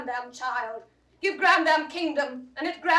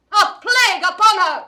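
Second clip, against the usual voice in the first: loud, higher, spat out; furious curse; 5 semitones higher, 6 dB louder than usual. Acted. Mother cursing the grandmother, loud and furious. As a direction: hurl the curse at her.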